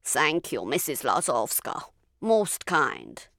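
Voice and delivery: a lightly voiced frail, hoarse doctor; hoarse old doctor. A narrator's reading. Ill doctor thanks his landlady at the door, hoarse. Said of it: "a hoarse voice said".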